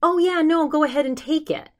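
eager to please. Retold or imitated. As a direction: offer it up sweetly to please an elder. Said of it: "my instinct was to say".

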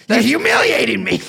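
high, hammed-up outrage; mock-angry Boston husband; exasperated spouse. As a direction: scold a drunk wife in mock fury. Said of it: "When your wife".

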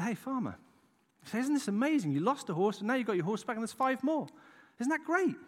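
high, eager mock cheer; excited neighbours. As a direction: play the gushing neighbour, pitch up.

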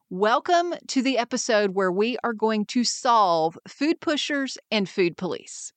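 ordinary delivery

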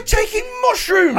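very high, drawn-out shout; mock outrage. Friend acting out the reply to a cheating ex, mock outraged shout. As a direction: shriek it in hammed-up disbelief.

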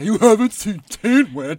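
loud, high, slow mock outrage; hammed-up disbelief. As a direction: exclaim it in exaggerated shock. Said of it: "I'll be like".

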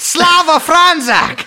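high mock Slavic accent, drawn out; put-on accent. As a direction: proclaim it slowly like a salute in a fake accent. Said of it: "I was doing it in an accent".

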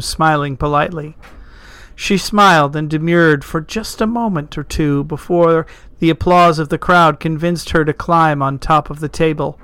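usual speaking voice

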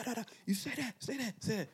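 high, barked mock command; mock soldier order. Soldier on plane barking orders, high mock command.

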